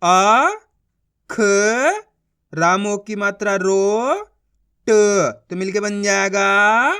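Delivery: slow, spaced-out syllables, then the whole word stretched; teacher modelling blending syllables into a word; syllable-by-syllable reading drill. Shown as a demonstration. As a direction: sound out each syllable for children, then blend.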